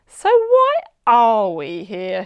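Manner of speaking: slow, loud, puzzled; curious girl. A narrator's reading. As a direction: ask it wonderingly. Curious child.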